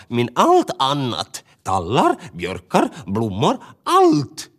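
bird listing in dismay; building bird outcry; Tova the bird. Acted.

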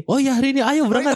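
high, bright eager acceptance; eager yes. Retold or imitated. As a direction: jump at the invitation. Guest eagerly accepting invite, acted.

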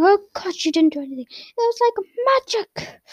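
hammed-up exasperation; lightly voiced upset uncle; mock Vernon. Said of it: "So he's like".

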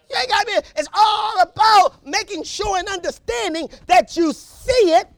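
high, loud preaching cry; whooping preacher building to a shout; full-force preaching. Acted.

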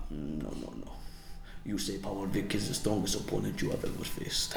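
low, soft mock-Nigerian accent; put-on Nigerian accent. Camera crew correcting the wrestler's interview, in a low imitated Nigerian accent. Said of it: "What a racist accent".

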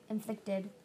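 usual speaking voice

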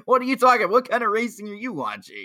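high, loud disbelieving challenge; mock incredulity. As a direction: imitate an outraged fan demanding answers. Acted incredulous fan rebuttal.

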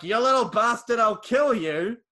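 loud, furious outburst from the father; angry threat. Retold or imitated. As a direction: roar it like he means to kill. Dad threatens son.

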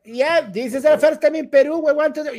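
high, bright Indian English tourist; Indian English accent. Retold or imitated. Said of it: "hablaban igualito que Kutrapali".

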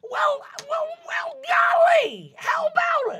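high, bubbly gnome father; excited gnome dad. Acted. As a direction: squeal with delight.

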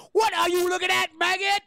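high, loud barking drill instructor; drill sergeant. Retold or imitated. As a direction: yell at recruits like a sergeant. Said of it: "he's just yelling at the kids".

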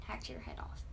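spooky whispered punchline; creepy whisper. Acted. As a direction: whisper it like a horror story. Gory guillotine description.

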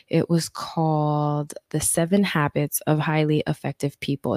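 usual speaking voice

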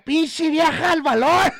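put-on rude high kids' yell; mock kids' shout. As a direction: yell it like cheeky brats. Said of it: "niños gritándole". (niños groseros gritándole a la señora)

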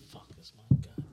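muttered whisper to himself. Real, not acted. Caught grabbing the wrong trophy, whispering. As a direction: hush it under the breath.

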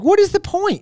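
high-pitched, incredulous protest; mock exasperation. Fan protesting a remake with no Sebastian, exasperated. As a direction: throw it out in exaggerated disbelief. Hypothetical outraged reaction to remake.

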